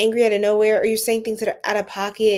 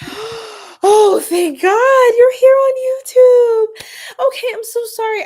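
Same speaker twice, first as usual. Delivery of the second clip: gasp, then much higher and louder, slow and crying; tearful relief; 12 semitones higher, 9 dB louder, slower than usual. Real, not acted. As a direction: burst out in relieved tears. The blocked viewer reappears on YouTube.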